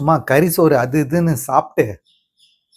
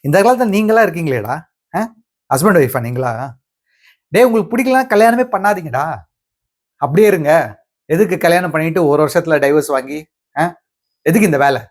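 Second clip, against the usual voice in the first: raised, emphatic telling-off; indignant scolding; 4 semitones higher, 4 dB louder than usual. Acted. Lawyer berates a couple seeking divorce, indignant scolding. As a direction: scold them with sharp rhetorical questions.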